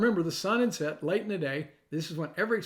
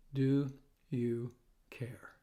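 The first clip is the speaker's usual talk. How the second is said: unvoiced, slow, softer whisper; whispered doubt; 4 semitones lower, 4 dB quieter, much slower than usual. Believer asking Jesus in private fear, hushed whisper. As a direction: breathe the question almost silently. Preacher voices the fearful question.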